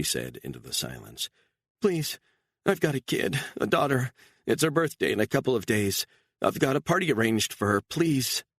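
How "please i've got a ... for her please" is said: high, begging woman's voice; pleading mother. A narrator's reading. Mother begging her captor to let her go, high and pleading. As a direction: plead for your daughter's sake.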